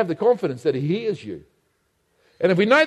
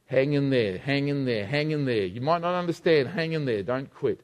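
softer, lower pastoral plea; tender appeal; 7 semitones lower, 4 dB quieter, slower than usual. Real, not acted. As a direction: lay it gently on a struggling friend.

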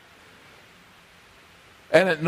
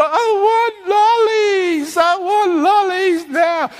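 put-on high, drawn-out wailing child; hammed-up tantrum wail, stretched and high; whinging child; 9 semitones higher, slower than usual. Child interrupting a parent to demand lollies, crying whinge. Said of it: "continuously whinges and complains".

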